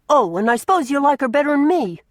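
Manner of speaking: sulky jealous accusation. A narrator's reading. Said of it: "said Mary jealously".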